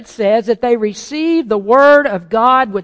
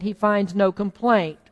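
ordinary talk

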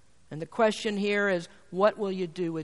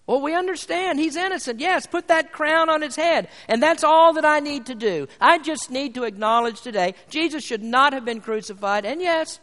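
louder, higher pulpit voice; forceful preaching; 5 semitones higher, 6 dB louder than usual. Acted. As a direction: press the point home to the listeners.

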